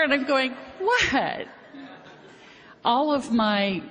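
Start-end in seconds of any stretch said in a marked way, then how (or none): 0.8-1.5 laughing surprise. Retold or imitated. Her surprised reply quoted.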